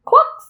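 high, quick cluck; storybook chicken noise. A narrator's reading. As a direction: cluck like a hen. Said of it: "said a chicken".

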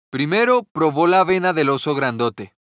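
plain reading voice

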